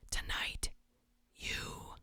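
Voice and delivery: hushed creepy whisper into the mic. Pretend. Streamer reading a creepy chat line, whispered. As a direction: breathe it like a stalker in your ear. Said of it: "whispering something creepy".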